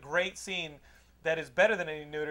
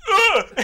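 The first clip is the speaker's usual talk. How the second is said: high, loud hammed-up grunt; mock grunt of effort; 13 semitones higher, 15 dB louder than usual. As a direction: grunt as if heaving a spear. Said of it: "he goes".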